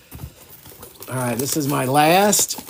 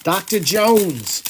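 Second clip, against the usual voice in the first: movie quote; 6 semitones higher, 5 dB louder, slower than usual. Retold or imitated. Indiana Jones movie quote.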